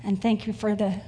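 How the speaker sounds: earnest, measured prayer voice. Acted. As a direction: congregation prayer before the sermon, reverent and unhurried. Opens sermon with prayer.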